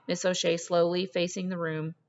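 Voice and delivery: ordinary delivery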